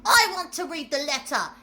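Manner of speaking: put-on a spoilt boy insisting; loud demand. A narrator's reading. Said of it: "he said loudly".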